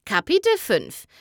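plain reading voice

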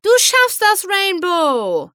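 very high, loud fan cheer. Acted. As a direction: shout encouragement from the crowd. (begeistertes, hohes Anfeuern)

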